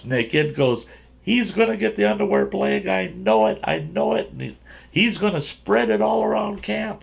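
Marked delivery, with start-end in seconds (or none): 1.2-7.0 put-on high-pitched, insistent kid tattling; mock-frantic high kid voice; alarmed kid. Panicked kid accusing to counselor.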